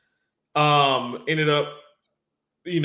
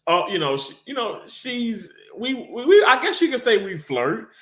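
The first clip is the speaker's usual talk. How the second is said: higher, coy voice of the flirty coworker; a lightly voiced flirtatious woman, higher pitched; imitating coworker; 8 semitones higher than usual. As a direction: put on her playful, coy tone.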